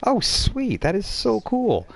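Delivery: high, slow, impressed gush; flirty delight. Acted. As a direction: coo it like a smitten fan.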